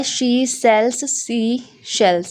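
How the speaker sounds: she sells sea shells drawn out, each s stressed; slow example read. Shown as a demonstration. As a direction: spell out the s sounds slowly. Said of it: "ये लिखा हुआ है".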